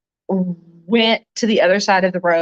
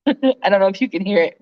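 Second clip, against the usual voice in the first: ordinary delivery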